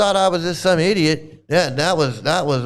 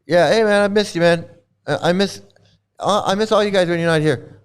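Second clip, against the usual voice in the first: ordinary delivery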